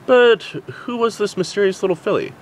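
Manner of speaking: plain reading voice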